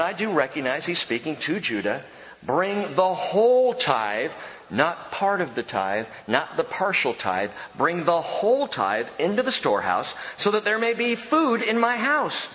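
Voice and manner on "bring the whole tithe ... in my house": solemn pulpit reading of scripture. Acted. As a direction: declare God's promise with weight. Reads Malachi scripture aloud.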